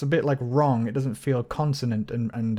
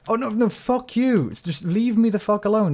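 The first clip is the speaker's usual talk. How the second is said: exasperated outburst; 8 semitones higher than usual. Real, not acted. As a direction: yell at the monsters to back off. Attacked by mobs in game.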